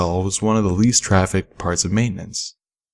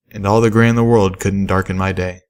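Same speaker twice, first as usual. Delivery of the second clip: ordinary delivery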